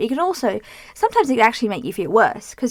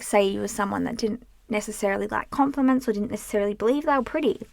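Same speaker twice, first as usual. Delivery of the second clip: ordinary talk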